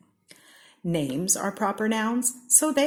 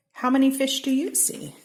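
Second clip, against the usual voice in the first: lively question; 8 semitones higher, 4 dB louder than usual. A narrator's reading. Asking child listener.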